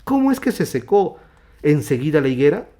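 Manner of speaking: loud, high question of astonishment; amazed. Acted. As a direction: ask it as marvelling disciples. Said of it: "decían maravillados".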